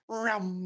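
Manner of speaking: high-pitched engine whoosh; car zooming past. Retold or imitated. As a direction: imitate a race car flying by. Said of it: "you hear 'em".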